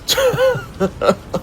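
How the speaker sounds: a high, scornful burst of laughter; mocking laugh. Handcuffed Tom laughs off his torturer, high and scornful. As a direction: laugh right in the villain's face.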